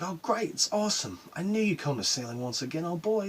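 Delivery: mock fatherly delight, pitch up; delighted dad. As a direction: imitate dad gushing over the news. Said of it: "He's like".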